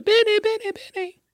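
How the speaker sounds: put-on very high falsetto kids' chant; squeaky repeated cheer; children's chorus. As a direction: chirp it over and over like kids on cue. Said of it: "They're going".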